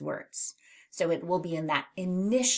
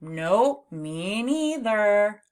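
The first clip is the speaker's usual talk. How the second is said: slow agreement; 2 semitones higher, 4 dB louder, much slower than usual. Shown as a demonstration.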